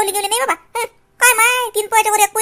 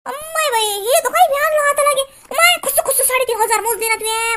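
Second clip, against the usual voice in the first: shocked exclamation; 8 semitones higher than usual. Acted. Grandma scandalized by price.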